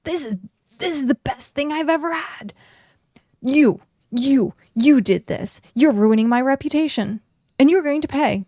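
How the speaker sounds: hissy fit. Acted. Bandit tasting the whiskey, throwing a hissy fit. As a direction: throw a huffy fit, building to a threat. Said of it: "he threw a hissy fit".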